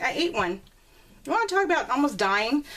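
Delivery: ordinary talk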